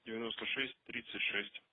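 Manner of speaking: quiet murmur while writing things down; muttering to himself. Real, not acted. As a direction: mumble it under your breath, half to yourself. Officer muttering while taking notes.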